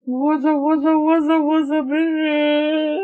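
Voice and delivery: very high sustained squeal; silly greeting. Pretend. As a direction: stretch the greeting out in one high silly note. Silly put-on greeting on introduction.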